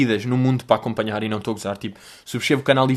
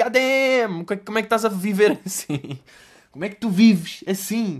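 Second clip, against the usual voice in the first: mock amazement; 6 semitones higher than usual. Amazed at fake people.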